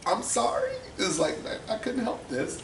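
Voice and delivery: high-voiced retold apology; pleading apology. Son apologizing, acted.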